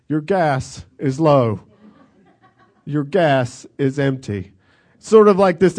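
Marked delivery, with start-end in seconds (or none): 0.1-4.5 lower, slow mock car voice with a laugh; smug talking car. Dead car taunts the stranded driver, slow and mocking. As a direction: tease him like a gloating machine. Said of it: "he could just hear that thing going".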